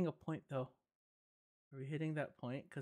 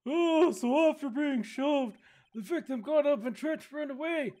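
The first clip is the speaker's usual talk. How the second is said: put-on higher, slow old judge; old man voice; 8 semitones higher, 4 dB louder than usual. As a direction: croak it like an ancient judge. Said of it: "since I did this voice".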